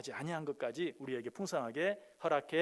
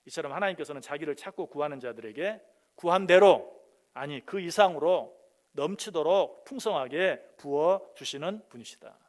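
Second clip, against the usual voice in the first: usual speaking voice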